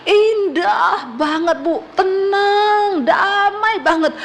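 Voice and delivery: slow, laughing and tearful, rising to a cry; overwhelmed with joy. Retold or imitated. Dying man tells his wife of heaven, tearful rapture. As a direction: let the joy spill over, half laughing, half crying.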